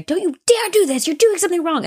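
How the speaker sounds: crazy stall lady. Pretend. Woman in the stall scolding a peeking child, high mock threat. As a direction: scare the kid off, hammed up. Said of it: "you terrify them".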